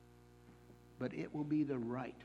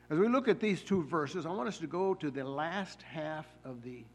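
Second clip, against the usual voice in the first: ordinary delivery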